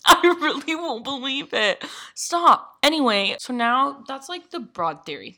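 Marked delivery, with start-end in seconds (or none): 0.1-2.8 high, loud, laughing stop; laughing protest. Real, not acted. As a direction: giggle through the protest.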